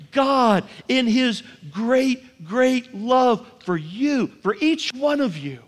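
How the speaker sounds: drawn-out, loving emphasis on love; tender and slow. Acted. God's love described tenderly.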